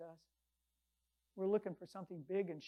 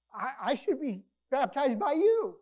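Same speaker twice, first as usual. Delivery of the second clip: higher, louder and slow, amazed; John the Baptist protesting to Jesus, raised voice; humbled protest; 5 semitones higher, 12 dB louder than usual. Retold or imitated. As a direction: insist you're not worthy. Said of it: "John the Baptist".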